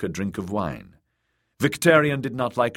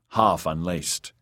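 usual speaking voice

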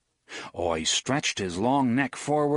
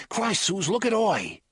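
urgent; 9 semitones higher than usual. Acted. Eddie startled by Oi.

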